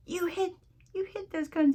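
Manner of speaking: very high, hammed-up film impression; high-pitched quoted exclamation; movie character quote. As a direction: act out the movie line way up high. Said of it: "remember when his friend hits that".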